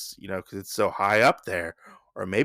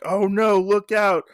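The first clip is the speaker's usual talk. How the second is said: higher, louder mock cry of alarm; panicked warning; 9 semitones higher, 4 dB louder than usual. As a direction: shout a frantic warning upward. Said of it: "the villagers say below".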